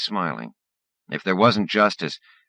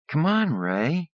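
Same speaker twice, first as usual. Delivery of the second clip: even higher drawn-out whine; whiny pleading; 12 semitones higher, slower than usual. Acted. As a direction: coax him, pitch rising. Andrea whines at Ray.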